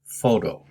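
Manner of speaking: "photo" stressed on the first syllable; "photo" with a strong first syllable, weak second. Shown as a demonstration. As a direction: hit the start of "photo". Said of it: "Pronunciation is on the first syllable".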